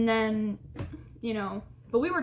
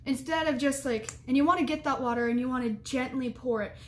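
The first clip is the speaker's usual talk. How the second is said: ordinary talk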